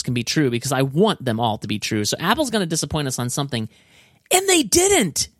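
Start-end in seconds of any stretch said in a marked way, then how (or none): 4.3-5.3 high, thrilled disbelief; squealing with delight; excited. Real, not acted. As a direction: gush that they delivered. Amazed Apple met every rumor.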